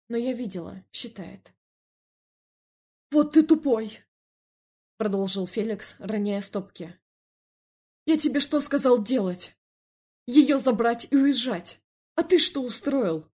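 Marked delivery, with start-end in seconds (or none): 3.1-4.0 loud, high exasperated jab; angry insult. A narrator's reading. Felix to his brother while dropping cash, angry. 8.1-13.3 loud, higher-pitched dressing-down; angry scolding. A narrator's reading. Felix scolding his brother for disobeying, angry.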